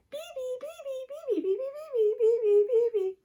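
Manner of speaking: high, squealed B B chant; a little boy's piercing repeated call. Acted. B B boy calling from the fence, high squeal. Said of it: "The B B boy looks up on the fence and says".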